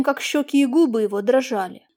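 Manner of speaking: usual speaking voice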